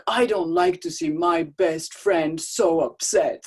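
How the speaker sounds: slow, loud cartoon bear. Mother as bear comforting her son, slow and loud. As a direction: comfort him as a gentle bear. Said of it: "my bear voice".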